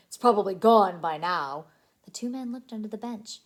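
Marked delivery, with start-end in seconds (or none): 0.1-1.7 gruff. Acted. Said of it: "said the tall man".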